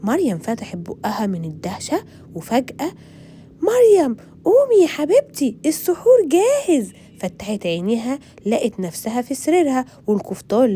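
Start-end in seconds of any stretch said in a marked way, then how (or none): 3.6-6.9 high, loud wake-up call across the room. Acted. Mother calling to wake Maryam. (نداء عالي لإيقاظ مريم)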